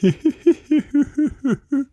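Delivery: high, tiny creature giggle; quiet silly giggle. Acted. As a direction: giggle like a playful little monster hiding in a tree. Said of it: "A quiet, silly kind of laughing".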